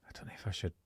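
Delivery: hushed, unvoiced muttering to himself; hushed aside. Worried he says too much, murmured aside. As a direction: keep it under the breath, unsure. Retold awkward hesitant question.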